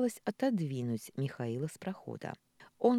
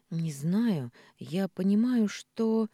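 tearful; 5 semitones higher, 6 dB louder, slower than usual. A narrator's reading. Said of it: "Маша всхлипнула".

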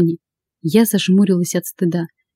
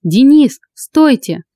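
higher, louder call to stop; raised-pitch narrated shout; 6 semitones higher, 4 dB louder, much slower than usual. A narrator's reading. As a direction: call urgently after someone walking away. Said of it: "закричала я".

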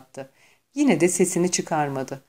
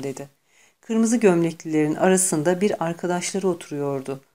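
ordinary delivery

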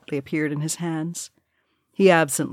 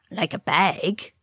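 slow, put-on sniggering dwarf voice; understated snigger in the voice; sniggering jibe; slower than usual. A narrator's reading. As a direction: slip in a drawn-out snide jibe. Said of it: "sniggered a dwarf".

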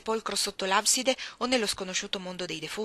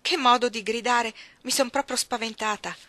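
louder, higher shocked cry; the maid still shaken by the scream; startled exclaiming; 4 semitones higher, 6 dB louder than usual. Acted. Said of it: "esclamò Abbott". (Abbott spaventata esclama ad alta voce)

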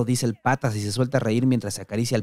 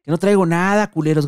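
much higher and louder, struggling yell; shouting protest; 6 semitones higher, 6 dB louder than usual. Acted. Dani yelling while held and searched, struggling. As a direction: kick and shout to get free. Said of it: "grita Dani".

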